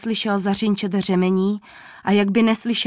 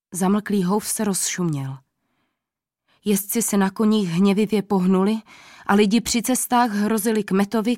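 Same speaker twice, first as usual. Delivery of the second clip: ordinary delivery, nothing marked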